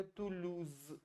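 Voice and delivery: तुलूज ending in a soft ज, the s voiced; quiet demo, तुलूज with ज at the end; French Toulouse. Shown as a demonstration. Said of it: "ज की ध्वनि देता है". (धीमे से तुलूज, अंत में ज)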